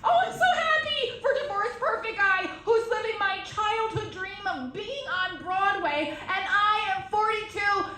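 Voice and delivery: high, soft, strained sarcasm climbing to a squeal; mock happiness. Performer answering her therapist about his success, hammed-up sarcastic happiness.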